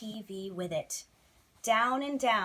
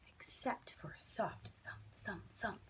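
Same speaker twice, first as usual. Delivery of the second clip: hushed; 11 dB quieter than usual. A narrator's reading. Soft thump read hushed.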